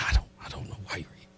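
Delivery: very low, soft and quick; hushed reply. Retold or imitated. Preacher replying to a troubled stranger, hushed. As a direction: answer almost under your breath.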